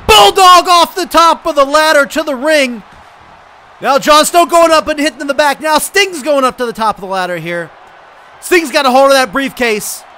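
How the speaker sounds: high, loud running commentary; excited play-by-play. Real, not acted. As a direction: belt out the action as it unfolds. Climb for the briefcase called live.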